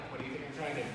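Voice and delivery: low, soft exasperated grumble; mock insulted. Gamer answering unwanted advice, mock insulted. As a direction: answer back under your breath. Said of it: "you feel kind of insulted".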